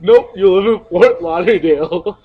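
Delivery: loud, teasing contradiction; mock insistence. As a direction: insist loudly over her, imitating the argument.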